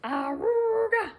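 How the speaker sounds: slow, high, loud horn call; loud honk call. Acted. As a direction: blast it like a bus horn. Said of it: "Harry honked his horn".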